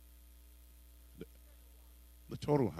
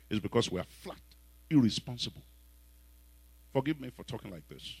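normal talking voice

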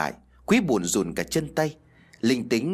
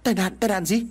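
high-pitched, shocked questioning; horrified; 9 semitones higher than usual. A narrator's reading. Son hearing of his parents' accident, horrified. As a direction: echo the word in alarm. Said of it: "Quý kinh hoàng đứng bật dậy".

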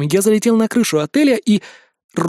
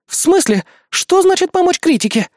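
high-pitched outraged questions; indignant; 12 semitones higher, 3 dB louder than usual. A narrator's reading. Мария to the departing hero, indignant. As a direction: demand an explanation in a high voice. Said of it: "возмутилась Мария Артисан".

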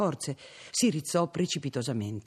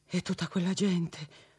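trembling dread; 5 semitones higher than usual. A narrator's reading. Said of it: "labbra contratte e tremanti di spavento".

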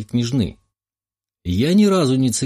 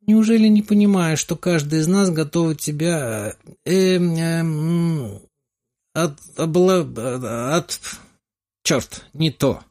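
tipsy voice, stumbling over words; tongue tangled with wine, losing the thread; drunk slurring; 6 semitones higher, slower than usual. Acted. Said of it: "борясь с заплетающимся языком".

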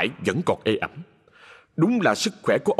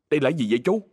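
puzzled, higher-pitched query; surprised question; 4 semitones higher, much faster than usual. A narrator's reading. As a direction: ask with mild surprise, lifting the pitch. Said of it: "Mạnh ngạc nhiên hỏi". (hỏi hơi ngạc nhiên, giọng cao lên)

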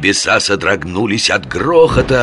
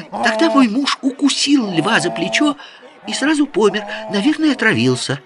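put-on high, rapid girlish chatter. A narrator's reading. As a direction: rattle it off like an excited girl. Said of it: "быстро затараторила Джамиля".

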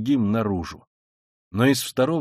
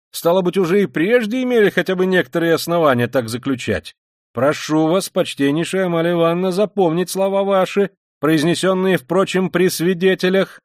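higher, pressing, formal insistence; officious Luzhin; 5 semitones higher, 5 dB louder than usual. Acted. Luzhin making the landlady a witness, officious insistence. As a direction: pin every word down officiously.

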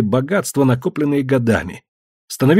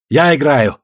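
eager, slightly raised call; eager announcement; 3 semitones higher, 4 dB louder than usual. A narrator's reading. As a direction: declare it firmly to join in. Player jumps into the game.

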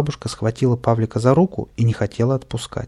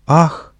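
a loud gasp of shock, higher; startled gasp; 5 semitones higher, 7 dB louder than usual. Acted. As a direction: gasp out in alarm. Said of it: "только ахнула".

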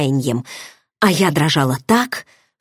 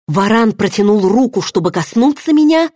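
usual speaking voice

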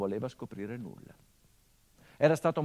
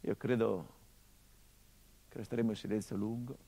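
tender letter reading; 2 semitones lower than usual. Acted. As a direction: let each phrase hang in the silence. Reads father's letter over music.

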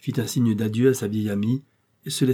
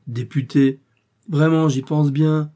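a scornful, louder "Député!" drawn out slow; scoffing surprise; 5 semitones higher, 6 dB louder, slower than usual. A narrator's reading. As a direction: toss "Député!" back as absurd. Guy scoffs at the idea. ("Député !" lancé avec un mépris incrédule, plus fort et lent)

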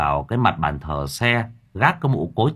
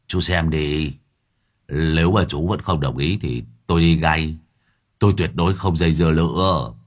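lightly voiced Lâm Thọt; 5 semitones lower than usual. A narrator's reading. Lâm Thọt offering the knife as payment, meek.

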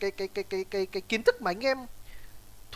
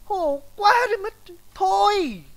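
exasperated; 8 semitones higher, 7 dB louder, slower than usual. Real, not acted. Exasperated at silly question.

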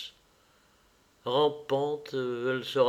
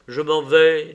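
louder, clearly projected, level farewell; calm, clear voice; 3 semitones higher, 10 dB louder than usual. Acted. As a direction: announce it firmly and composedly. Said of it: "d'une calme voix claire". (voix calme et claire)

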